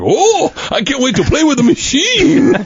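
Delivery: high, slow mock glee; imitated giddy anticipation; kid on Christmas. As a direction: squeal it like a thrilled child. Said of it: "looks like a kid on Christmas".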